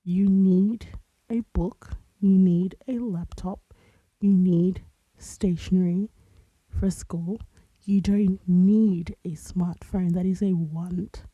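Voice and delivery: loud, emphatic imitation of a mom listing needs; imitated motherly lecture, punching the key words; lecturing mother. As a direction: spell it out firmly, item by item, like a parent. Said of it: "That's what my mother told me".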